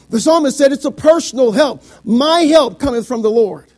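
loud pulpit declaration; emphatic preaching. Real, not acted. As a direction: declare the scripture firmly and loudly. Preaches at full force.

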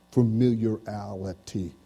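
"familiarity" stretched syllable by syllable, slow; drawn out slowly. Shown as a demonstration. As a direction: draw out "familiarity" slowly, as a question.

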